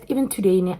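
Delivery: ordinary delivery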